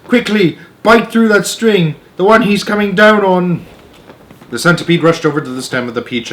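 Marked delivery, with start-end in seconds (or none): 0.1-3.6 put-on urgent boy. A narrator's reading. James urging the centipede to bite the string. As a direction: press him to act now. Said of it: "yelled James".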